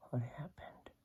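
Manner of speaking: hushed whispered reveal. Pretend. Revealing to the children what the storm did, whispered. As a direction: whisper the sad news.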